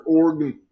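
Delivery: Oregon squashed to two syllables, "OR-gun"; deliberate mispronunciation. Pretend. Needling Oregon listeners for hate mail, Oregon squashed to "OR-gun". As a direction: drop the middle of Oregon, "OR-gun". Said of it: "I'm gonna mispronounce it even harder".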